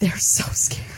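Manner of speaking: hushed, scared whisper. Real, not acted. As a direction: breathe it out in mock fright. Whispered fear of seals.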